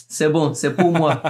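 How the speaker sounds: put-on light Congolese accent, playful quote; imitating a Congolese speaker's French accent. Guest quotes his film character in a light Congolese accent. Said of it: "j'ai pris l'accent congolais".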